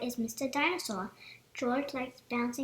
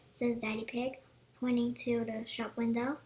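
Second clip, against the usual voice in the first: plain reading voice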